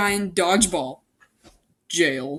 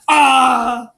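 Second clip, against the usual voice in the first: loud high startled scream; 4 semitones higher, 8 dB louder than usual. Acted. Macy grabbed from behind, startled scream. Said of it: "I screamed".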